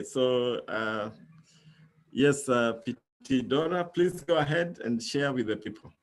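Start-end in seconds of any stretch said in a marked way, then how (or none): none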